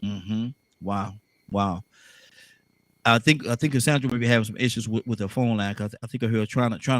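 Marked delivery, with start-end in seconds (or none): none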